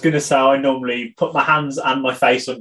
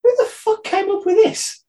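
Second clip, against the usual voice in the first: high, slow, incredulous cry; who on earth did this, said in shock; pitched-up, drawn-out outrage; outraged disbelief. Real, not acted.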